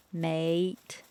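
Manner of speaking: a broad Australian "Mate" with a rising end; a rough bogan "Mate"; broad Aussie "Mate", ending up. Shown as a demonstration. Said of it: "in a bogan way".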